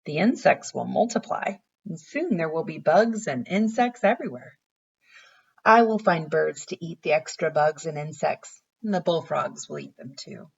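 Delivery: gentle storybook read-aloud; reading to children. Acted. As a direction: read it like a picture book for little kids. Teacher reads picture book aloud.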